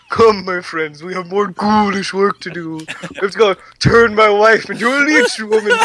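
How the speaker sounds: hammed-up ghoulish puppeteer voice; Toulon. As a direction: play a theatrical puppeteer rallying his friends. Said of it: "your impression of Toulon".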